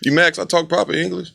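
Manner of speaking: mock a kid challenging his dad; lightly put-on annoyed son's voice; imitating indignant son. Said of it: "he get mad at me".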